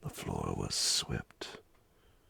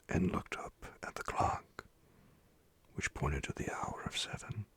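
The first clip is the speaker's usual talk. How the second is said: usual speaking voice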